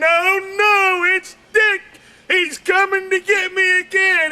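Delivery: high, squealing panic; mock dread. Wife in bed dreading her husband again. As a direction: shriek of mock terror. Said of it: "she's thinking".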